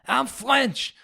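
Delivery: put-on high-pitched comic French accent; exaggerated movie-quote French accent, way up high; outrageous French accent. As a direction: play an indignant Frenchman with a hammed-up French accent. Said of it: "this outrageous accent".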